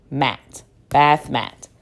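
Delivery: ordinary talk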